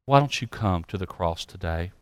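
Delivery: low, soft pastoral appeal; hushed, low closing plea; altar-call invitation. Real, not acted. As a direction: gently urge them to come, with pauses between phrases.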